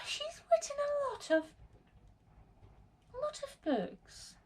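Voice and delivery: high whispered surprise; high, hushed amazement at the booklist; surprised whisper. Real, not acted. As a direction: breathe it out, amazed. Surprised by author's many books.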